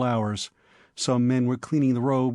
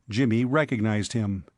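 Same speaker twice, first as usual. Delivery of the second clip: usual speaking voice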